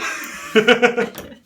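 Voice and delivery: high, loud mock villain laugh; sinister laugh. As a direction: cackle like a cartoon villain. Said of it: "That was my sinister laugh".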